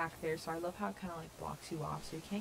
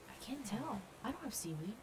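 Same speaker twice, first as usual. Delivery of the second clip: ordinary talk, nothing marked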